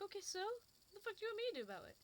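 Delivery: high, incredulous snap back at the customer; exasperated retort. Retold or imitated. To the woman complaining about beans, an exasperated high retort. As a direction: fire it back like you've had enough. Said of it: "I'm like".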